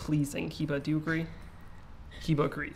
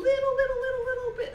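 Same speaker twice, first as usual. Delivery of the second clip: squeaky high "little". Real, not acted. Stressing a tiny amount.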